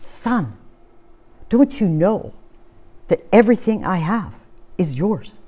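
soft, gentle reassurance from a father; tender father. Retold or imitated. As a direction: speak it softly to a hurt son. Father answers elder son tenderly.

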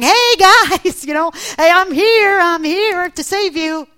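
loud, high holler across the water; hammed-up "here I am" hail; mock calling out. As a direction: announce yourself with a big showy shout. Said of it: "Jesus didn't come out on the water saying".

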